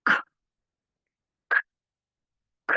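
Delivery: repeated খ as the k sound; k sound drill; letter sound demo. Shown as a demonstration. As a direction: say খ short, three times. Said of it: "Like first of all".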